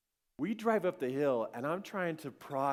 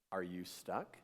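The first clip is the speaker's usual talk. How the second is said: low, hushed, creepy stranger; 10 semitones lower, 9 dB quieter than usual. Retold or imitated. Stranger pulls up beside stuck dad, creepy and quiet. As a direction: imitate an eerily calm rescuer. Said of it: "That was the creepiest conversation".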